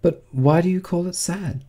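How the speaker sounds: put-on high, light girl's voice; puzzled Alice. A narrator's reading. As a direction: ask in innocent puzzlement. Alice's puzzled question to Mouse.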